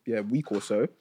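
big, drawn-out show-opener into a news read; lifted, punchy news-read delivery; news announcer. Acted. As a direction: present the headline like a news host. Presents the show's news segment.

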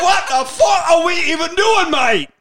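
put-on high, loud exasperated London accent; Michael Caine. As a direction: shout it as a hammed-up British film star. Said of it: "Michael Caine Bronson".

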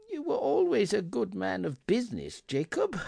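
high, hesitant unsure voice; faltering. A narrator's reading. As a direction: let the words falter. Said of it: "faltered Scrooge".

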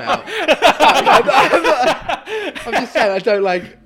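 words squeezed out through high laughter; can't stop laughing while trying to talk; giggling helplessly mid-sentence. Real, not acted.

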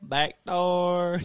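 drawn-out Southern country delivery; joking country-accent punchline. Retold or imitated. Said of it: "I was talking in a country accent".

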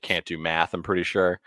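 normal talking voice

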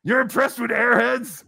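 high-pitched, indignant disbelief; incredulous. Real, not acted. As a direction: question it as if insulted. Incredulous at what impressed him.

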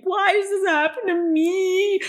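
high, drawn-out theatrical wail; mock crying wail. Retelling the soup spill meltdown, mock crying. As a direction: imitate a despairing cry, slowly. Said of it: "I'm crying".

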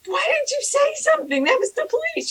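high-pitched incredulous scolding; exasperated mistress. Retold or imitated. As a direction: imitate an aghast telling-off. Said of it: "Mistress Gabrielle went to Tom".

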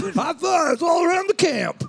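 high cheering shout; excited shout. Pretend. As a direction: cheer like celebrating at camp. Acting explorer, shout heard.

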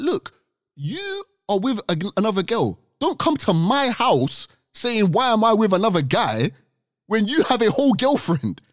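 lightly voiced girl, high and sassy; high girly voice. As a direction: play the girl, high and cheeky. Acting out the girl's words.

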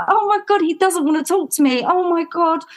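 high-pitched, half-laughing dismay; mock-distraught exclamation; retold dismay. Retelling dismay at uncle's rejection.

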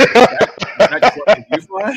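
loud, high disbelieving demand; incredulous shout. Retold or imitated. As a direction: yell it in disbelief. Danny demanding, retold.

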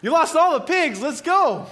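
high, loud exclamation; outraged alarm. Acted. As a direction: cry out and rally everyone, alarmed. Townsperson reacts, rallies the town.

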